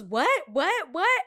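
high, loud repeated shocked what; shocked customer. Retold or imitated. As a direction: squawk it in disbelief.

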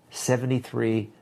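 usual speaking voice

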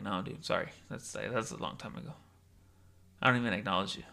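low-pitched, cool brush-off; mock-aloof refusal; dismissive rejection. Acted cold rejection of former friend.

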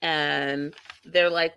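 usual speaking voice

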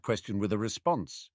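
ordinary delivery

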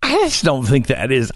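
ordinary delivery

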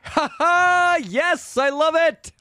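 very high, exultant whoop; hearty laughing delight. Acted. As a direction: crow with big triumphant laughs. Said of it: "slaps him heartily on the back and starts laughing".

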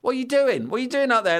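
high calling voices, mocking; girls calling up. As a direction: call up to the boy on the fence. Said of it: "they're stopping and going".

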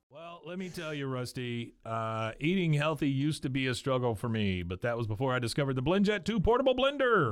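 scripted pitch with rising salesman lilt; sponsor ad read. Acted. Host pitching a portable blender to listeners, ad read. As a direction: sell it like a podcast ad.

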